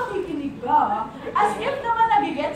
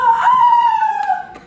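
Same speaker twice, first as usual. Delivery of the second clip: jealous outburst rising to a scream; hammed-up wail; 9 dB louder than usual. Single friend envies the flowers, hammed-up wail. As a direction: build to a long, high, loud cry.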